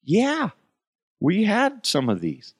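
a sudden falsetto coworker; high mock coworker voice. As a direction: play him high and goofy.

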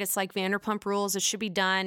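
ordinary delivery

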